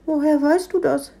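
high, amazed kitten question; astonished kitten. A narrator's reading. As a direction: ask like a baffled little cat. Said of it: "fragte das Kätzchen verblüfft".

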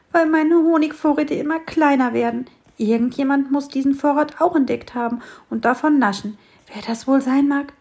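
high, loud worried bird; puzzled sparrow. A narrator's reading. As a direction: wonder aloud who the thief is.

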